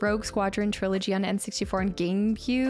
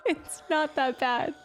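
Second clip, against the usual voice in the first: higher, slow, fake-tearful whine; mock whimper; 5 semitones higher, slower than usual. Streamer mock-pleads with teasing chat, fake-tearful whine. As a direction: pretend to be wounded, slowly. Repeating outfit defence, whining.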